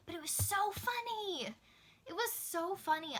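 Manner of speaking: high-pitched, delighted gush. Real, not acted. Telling viewers about a surprise favorite book, gushing. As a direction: rave about how funny it was.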